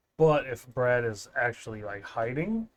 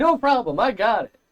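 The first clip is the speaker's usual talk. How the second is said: high, loud, overeager; hammed-up eager volunteer; eager mock cheer; 10 semitones higher, 10 dB louder than usual. Mocking Brad's eager voice.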